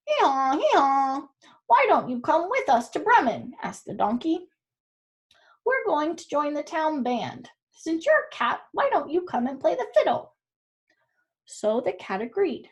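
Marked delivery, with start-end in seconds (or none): 0.1-10.3 braying donkey. Acted. Donkey invites the cat to join the Bremen band, braying. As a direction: bray then coax like a cheery donkey.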